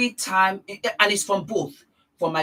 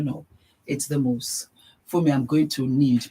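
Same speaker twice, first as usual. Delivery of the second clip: usual speaking voice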